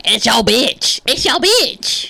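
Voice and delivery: drawn-out, rising mock holler; playful friend impression, pitch climbing; imitating Tierra. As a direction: mimic your friend, stretching the last word high. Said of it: "now Tierra's gonna be like".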